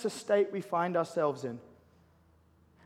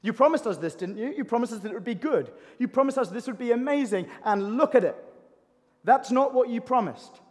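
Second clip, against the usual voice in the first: raised, high-pitched cry of complaint to God; angry, frustrated; 5 semitones higher, 6 dB louder than usual. Acted. Returned exiles demanding God keep his promises, angry and frustrated. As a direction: demand answers from God, loud and impatient. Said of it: "they're angry, frustrated, and impatient".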